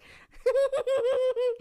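high, very loud cackle; loud laugh. Real, not acted. Telling friend about his reaction, loud high laughing fit. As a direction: burst out laughing mid-story.

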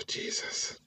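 breathless appalled whisper. Real, not acted. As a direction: whisper it in dismay. Appalled after sigh.

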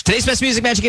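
radio DJ voice. Shown as a demonstration. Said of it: "you don't talk that fast".

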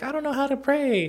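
lightly voiced clueless kid; naive kid. Retelling cartoon kid's dialogue.

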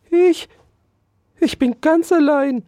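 high, squeaky stammering mouse; little mouse voice, a bit startled; timid mouse. Acted. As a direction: squeak it out, caught off guard. Mouse answers the hamster.

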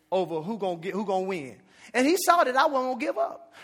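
ordinary talk

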